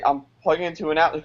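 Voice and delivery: ordinary talk, nothing marked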